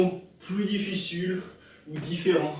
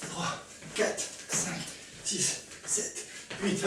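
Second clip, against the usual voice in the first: whispered counting; 8 dB quieter, much slower than usual. Real, not acted. Counting reps under breath.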